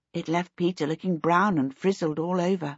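usual speaking voice

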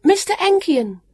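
high, loud call of "Mister Enkian"; brisk high-pitched name call. A narrator's reading. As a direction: shout "Mister Enkian" to carry. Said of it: "she called".

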